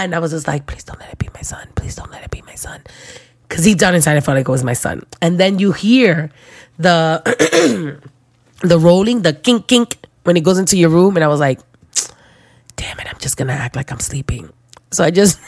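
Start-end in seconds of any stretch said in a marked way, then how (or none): 0.7-2.8 breathless whispered plea to herself; pleading whisper in the dark; desperate whisper. Retold or imitated. Praying it isn't her baby. 12.8-14.5 hushed, sneaky whisper to herself; conspiratorial whisper; whispered aside. Retold or imitated. Deciding to fake sleep.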